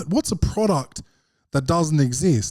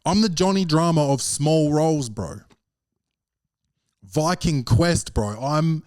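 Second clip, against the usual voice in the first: ordinary talk, nothing marked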